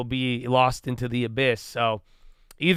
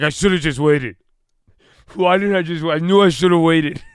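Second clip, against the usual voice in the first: louder, higher, whining self-reproach; regretful whine; 3 semitones higher, 5 dB louder than usual. Retold or imitated. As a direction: kick yourself for not waiting. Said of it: "and then I'm like".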